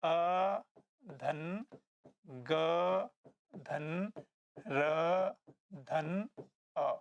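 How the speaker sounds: spelling out sound by sound, pauses between; drawn-out single sounds, each set apart; word broken up. Shown as a demonstration. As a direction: break it into separate pieces for a class. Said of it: "इसको शब्द को तोड़ोगे तो क्या आएगा".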